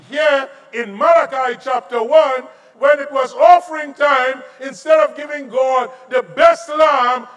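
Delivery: loud, high preaching of scripture; forceful preaching. Acted. Pastor preaching scripture to the congregation, loud and forceful. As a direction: thunder the verses at the congregation.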